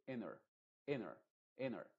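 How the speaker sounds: inner with the T dropped; American flap. Shown as a demonstration. Teacher imitates American speakers dropping the T. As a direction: say inner, letting the T vanish into the N. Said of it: "sin la T".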